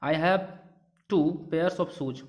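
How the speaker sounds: very slow, word by word example reading; slow dictation. Shown as a demonstration. Teacher dictates an example to the class, very slow. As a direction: read the sentence slowly so students follow. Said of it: "example sentence".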